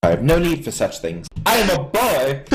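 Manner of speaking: lightly hammed-up boyish protest; indignant boy. Boy lord refuses servant's bribe, mock indignant.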